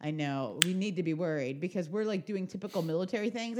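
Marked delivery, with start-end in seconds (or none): none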